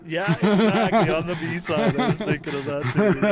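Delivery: laughing loudly while agreeing. Real, not acted. Laughing agreement about B sides.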